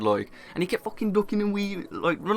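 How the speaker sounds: ordinary talk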